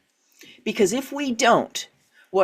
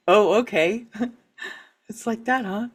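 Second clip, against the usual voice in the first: amused shrug; 3 semitones higher than usual. Shown as a demonstration.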